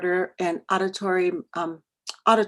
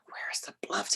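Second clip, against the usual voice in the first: much softer, whispered disbelief; alarmed inner thought; 11 dB quieter than usual. Retold or imitated. Mother thinking in alarm about her lost son, whispered. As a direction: whisper it to yourself in dismay.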